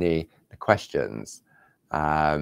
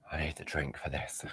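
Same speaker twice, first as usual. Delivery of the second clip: whisper; 3 semitones lower, 14 dB quieter than usual. Real, not acted. Aside while painting.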